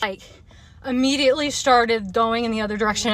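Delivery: normal talking voice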